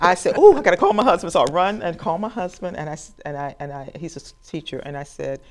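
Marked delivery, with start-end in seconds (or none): 0.4-1.3 high and loud with urgency; can barely contain the news; excited rush. Retold or imitated. Deciding to call her husband, thrilled.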